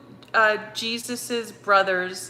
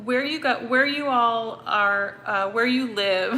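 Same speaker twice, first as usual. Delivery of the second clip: ordinary talk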